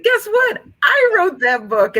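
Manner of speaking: high, loud, triumphant announcement; spring the big secret, slowing on the reveal; excited reveal. Retold or imitated. Teacher revealing to her reading group she wrote it, excited.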